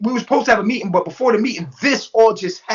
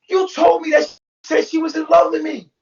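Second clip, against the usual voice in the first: retold accusation; 7 semitones higher than usual. Retold confrontation with Serenity.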